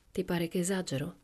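ordinary delivery

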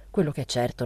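usual speaking voice

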